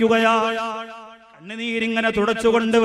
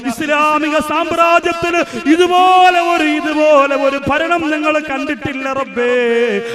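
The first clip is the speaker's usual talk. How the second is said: impassioned preaching; 5 semitones higher than usual. Acted. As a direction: lift the voice into a fervent plea. Impassioned prayer to God.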